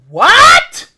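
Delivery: high, loud startled what; shocked shriek. Real, not acted. Gamer jolted back to life, shrieking in shock. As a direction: jolt up in disbelief.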